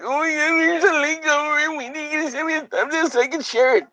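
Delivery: mocking Zaffa. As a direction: squeak it out like a fussy kid. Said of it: "Fucking Zaffa was like".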